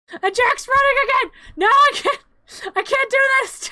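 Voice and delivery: very high, loud flustered shrieks; overwhelmed squealing. Real, not acted. Losing control as Jack runs again, high frantic squeal. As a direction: cry out helplessly in comic panic.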